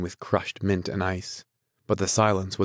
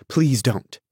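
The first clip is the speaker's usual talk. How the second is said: higher, louder helpless plea; helplessly pleading; helpless interruption; 5 semitones higher, 5 dB louder than usual. A narrator's reading. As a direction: beg them to stop. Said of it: "she interrupted helplessly".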